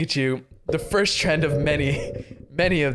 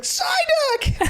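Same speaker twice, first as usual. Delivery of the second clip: very high laughing wail; mock anguished cry. Misty crying out as Psyduck gets sucked up, laughing wail. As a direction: wail the name through laughter. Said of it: "And Missy's like".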